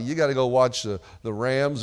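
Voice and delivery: usual speaking voice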